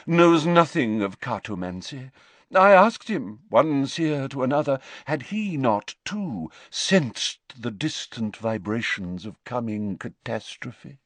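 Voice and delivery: high seer, grand and aggrieved; Professor Trelawney, offended. A narrator's reading.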